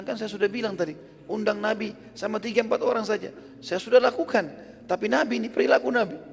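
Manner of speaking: high-pitched exasperated back-and-forth; flustered protest. Acted. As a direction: argue it out in an acted domestic fluster. Acted husband and wife argument.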